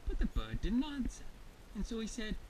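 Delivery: ordinary delivery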